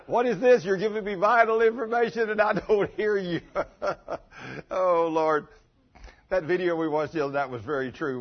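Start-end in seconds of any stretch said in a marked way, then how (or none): 0.1-3.4 exasperated husband. Retold or imitated. Husband complains can't hear.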